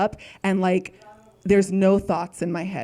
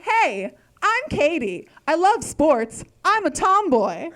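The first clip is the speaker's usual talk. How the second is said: put-on bubbly, higher-pitched camp kid introduction; big friendly energy; 7 semitones higher, slower than usual. Friendly girl introducing herself at camp, big upbeat energy. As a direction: greet them with a big, eager smile. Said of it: "great energy, big smile".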